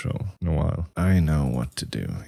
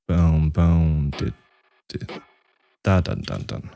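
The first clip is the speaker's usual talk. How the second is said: beatboxed rhythm. Shown as a demonstration. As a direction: boom and dit as kick and ghost notes. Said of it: "so that rhythm".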